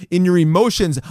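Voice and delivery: normal talking voice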